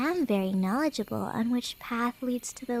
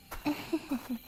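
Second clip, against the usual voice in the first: soft sly giggle; 9 dB quieter than usual. Acted. Stranger amused at the traveler.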